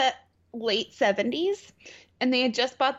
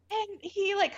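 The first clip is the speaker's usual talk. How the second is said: usual speaking voice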